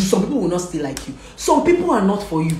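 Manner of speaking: usual speaking voice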